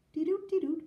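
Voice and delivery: high little toots; imitated pipe music. A narrator's reading. Piper warming up for rabbits, high imitated tooting. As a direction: play the pipe with your voice. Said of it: "he played".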